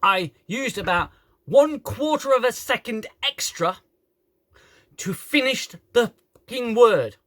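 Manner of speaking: high, loud, emphatic complaint; exasperated rant. Real, not acted. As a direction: spell it out in exasperation. Ranting about a clipped word.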